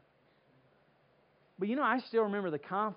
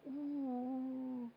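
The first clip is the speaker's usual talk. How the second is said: higher, hushed frightened ooh; scared whimper; 6 semitones higher, 10 dB quieter than usual. Retold or imitated. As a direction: breathe out a small terrified ooh. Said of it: "I was just like".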